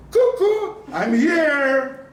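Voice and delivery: high, loud, giggly peekaboo call; baby peekaboo. Acted. Baby peekaboo playfully acted.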